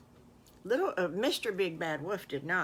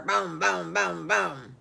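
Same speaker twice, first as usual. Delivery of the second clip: door knocks; 6 dB louder, slower than usual. A narrator's reading. Wolf bangs on the door.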